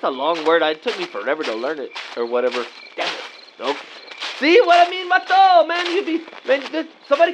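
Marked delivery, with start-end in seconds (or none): 2.9-3.8 whispered curse. Real, not acted. Muttered to self. 4.4-7.3 high, mocking gotcha; teasing. Teasing friend.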